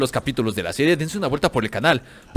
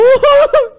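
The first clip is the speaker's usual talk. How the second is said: much higher, louder panicked cackle; shrieking laugh; 10 dB louder than usual. Real, not acted. Gamer realizing he has no ammo, shrieking laugh. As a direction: burst into a squealing laugh.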